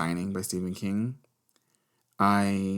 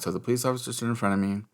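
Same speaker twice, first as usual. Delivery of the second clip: usual speaking voice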